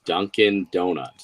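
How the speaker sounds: "Dunkin' Donuts" slow, with a weak Boston try; a failed shot at "Dunkin' Donuts" the Boston way; bad Boston accent. Shown as a demonstration. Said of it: "that was a bad one".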